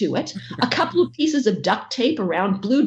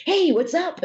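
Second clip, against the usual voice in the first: innocent greeting; 8 semitones higher than usual. Retold or imitated. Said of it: "little innocent Toby".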